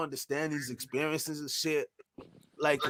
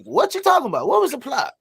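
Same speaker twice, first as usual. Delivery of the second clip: high, loud disbelieving demand; confused outburst; 10 semitones higher, 6 dB louder than usual. Retold or imitated. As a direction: burst in baffled, pitch jumping high. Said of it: "I walk in, like".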